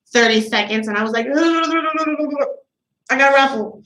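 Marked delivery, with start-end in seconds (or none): 1.3-3.8 garbled self-mocking mumble, drawn out; flustered host. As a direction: imitate yourself fumbling at the mic. Mocking own nervous hosting on mic.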